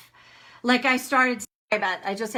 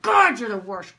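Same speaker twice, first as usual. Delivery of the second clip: louder, cathartic outburst at parents; a louder yell of release at her parents; venting yell; 6 dB louder than usual. Retold or imitated. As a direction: let the anger out in one loud push. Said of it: "Ah, like that".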